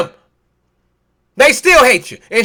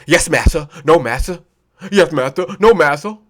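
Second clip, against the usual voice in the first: lowered mock-servile voice; obedient servant; 7 semitones lower than usual. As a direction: answer low, like an obedient slave. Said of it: "And you there, like a circus seal".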